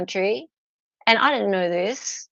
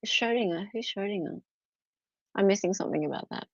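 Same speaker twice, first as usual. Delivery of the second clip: ordinary talk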